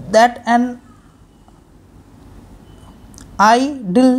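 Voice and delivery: "that an আইডল" with gaps between the parts; cut into syllables. Shown as a demonstration. Teacher cutting the line, "that an আইডল" piece by piece. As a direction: say "that", "an", long pause, "আই-ডল" split. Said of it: "এখানটায় কাটা হবে".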